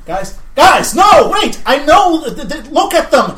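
loud, high, slow warning shout; urgent shout. Acted. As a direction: yell at them to wake up. Character warning the group urgently.

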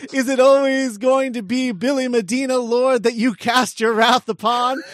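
high, loud mock-leprechaun lament. Billy rails at the Lord in the script, loud leprechaun lament. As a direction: wail it to the heavens as a put-on leprechaun. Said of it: "then in a leprechaun voice".

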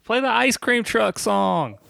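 high, drawn-out mock holler; imitated shouting. Imitating a friend requesting a song, high mock shout. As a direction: heckle the band in a stretched high voice. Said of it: "Groove was just shouting".

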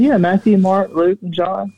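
lightly voiced friend, pleased with herself; voicing the friend. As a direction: rattle off the list in her voice. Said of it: "She said".

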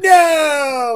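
high, loud "No!" of disbelief; disbelieving shout. Retold or imitated. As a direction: yell it like you can't believe it. Brother's coworker can't believe it.

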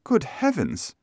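high-pitched exclamation without added volume; startled lady's high gasp of words; shocked cry. A narrator's reading. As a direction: cry out in alarm, pitched well up. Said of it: "she cried".

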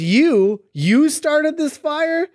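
lightly acted slow, incredulous question; Spider-Man. Reading Spider-Man confronting the Goblin, lightly acted disbelief. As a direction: pitch climbs in mock disbelief, drawn out. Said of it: "Spider-Man says".

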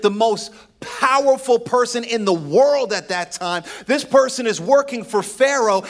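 loud, high preacher's build; preaching at full force. Acted. Pastor preaching to the congregation, full force. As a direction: preach it out to the church.

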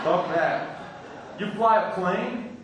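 ordinary delivery

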